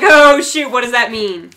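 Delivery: imitating a startled, clueless reaction; imitated startled mom. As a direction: echo it back loud, then puzzled. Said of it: "And she's like".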